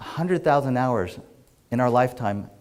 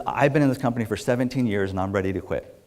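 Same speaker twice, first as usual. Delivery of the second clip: normal talking voice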